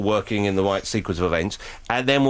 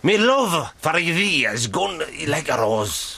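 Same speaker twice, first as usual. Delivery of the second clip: put-on Scottish accent; 3 semitones higher, much slower than usual. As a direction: ham up an old-fashioned Scottish accent. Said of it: "I can do the Scottish accent quite well".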